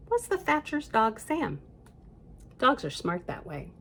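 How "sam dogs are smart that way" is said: ordinary delivery, nothing marked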